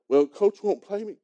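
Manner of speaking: mock a sulky kid complaining; a hint of a pouting child; whiny kid. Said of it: "they just say the dumbest stuff".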